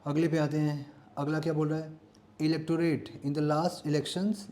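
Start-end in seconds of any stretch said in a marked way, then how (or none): 2.4-4.5 slow reading. Shown as a demonstration. Teacher reads exam sentence slowly.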